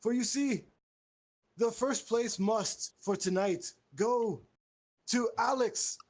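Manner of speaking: high, slow ceremonial proclamation; grand pompous master. Acted. Host awarding Alex first place, mock-grand proclamation.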